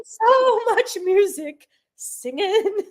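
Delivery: high, slow Southern twang; Southern drawl. Retold or imitated. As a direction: drawl it out high like the flustered dancer. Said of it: "went Southern".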